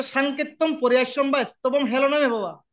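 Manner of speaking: slow verse recitation. Acted. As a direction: chant it slowly like scripture. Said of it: "বলছে". (ধীর লয়ে শ্লোক পাঠ)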